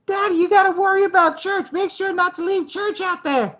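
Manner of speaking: put-on very high, whiny little-girl voice; squeaky mock child pleading; annoying daughter. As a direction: pester like an annoying kid, high-pitched. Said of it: "the annoying daughter".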